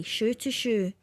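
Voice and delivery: plain reading voice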